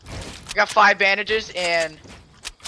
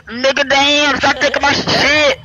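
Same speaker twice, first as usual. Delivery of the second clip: put-on loud, higher, drawn-out kid's complaint; mimicked whiny shouting; 5 semitones higher, 6 dB louder, slower than usual. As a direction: imitate a kid yelling in protest. Mimicking kid complaining after being looted.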